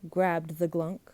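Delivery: plain reading voice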